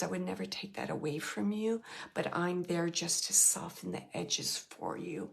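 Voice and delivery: soft, hushed words of love; tender farewell. Retold or imitated. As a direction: relay his love quietly and gently. Loving farewell from the dead boyfriend.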